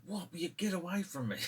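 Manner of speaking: high, fast irritated retort; annoyed brush-off. Retold or imitated. Mick snapping at the bar crowd, high and exasperated. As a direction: shoo them off, exasperated. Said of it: "He's like".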